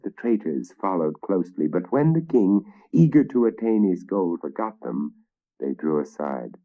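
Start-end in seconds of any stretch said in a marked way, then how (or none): none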